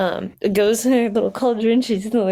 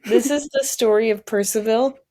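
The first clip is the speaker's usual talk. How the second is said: usual speaking voice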